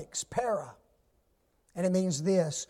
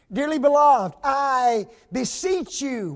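louder, higher, beseech drawn out slowly; fervent appeal; 5 semitones higher, 5 dB louder, slower than usual. Acted. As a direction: plead with them.

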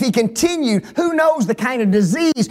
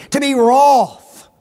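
wroth slow and louder; emphatic slow climax; 4 semitones higher, 6 dB louder, slower than usual. Real, not acted. Preacher defining anger, slow loud wroth. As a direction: land the last word hard.

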